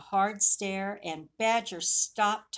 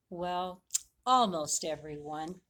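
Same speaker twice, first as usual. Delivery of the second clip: ordinary delivery, nothing marked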